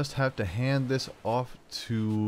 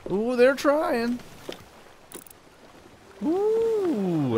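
mock alarm; 14 semitones higher, much slower than usual. Real, not acted. Reacting to enemies gaining.

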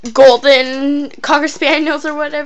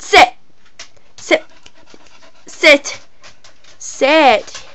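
normal talking voice

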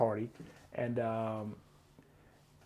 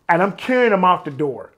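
normal talking voice

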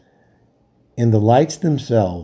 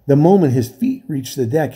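ordinary delivery

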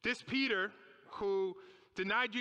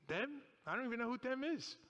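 feigned ignorance; 3 semitones higher, 4 dB quieter than usual. Acted. Acts Peter disowning the group.

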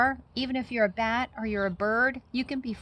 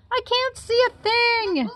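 much higher, louder panicked yell; 5 dB louder than usual. A narrator's reading. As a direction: yell it blind in the dark. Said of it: "yelled Pip".

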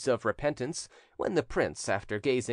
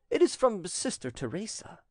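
plain reading voice

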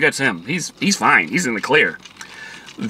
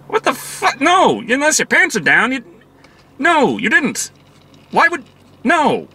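much higher, disbelieving outburst; incredulous; 9 semitones higher than usual. Retold or imitated. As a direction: scoff in utter disbelief at the movie. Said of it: "And I'm thinking, like".

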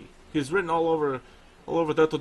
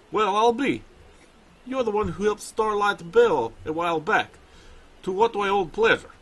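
put-on light Russian accent, pitched higher; 5 semitones higher than usual. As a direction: voice the bear trainer with a Russian accent. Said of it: "I'm gonna give him a Russian accent".

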